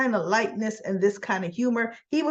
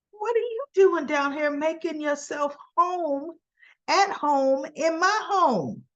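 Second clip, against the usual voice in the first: higher, incredulous Jack; indignant imitation; 7 semitones higher than usual. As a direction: demand to know why he's here. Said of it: "And Jack looks like".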